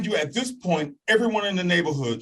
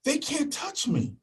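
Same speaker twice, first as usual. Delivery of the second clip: gleeful; 13 semitones higher, 5 dB quieter than usual. Retold or imitated. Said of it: "I'm like".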